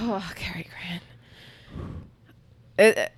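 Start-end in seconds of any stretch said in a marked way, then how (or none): 0.0-1.0 whispered, adoring sigh of a name; swooning whisper. Pretend. Co-host swooning over a favourite actor, whispering. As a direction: breathe the name out in a hushed swoon.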